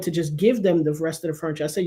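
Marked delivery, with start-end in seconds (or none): none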